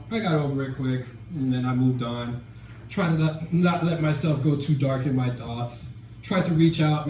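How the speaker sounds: usual speaking voice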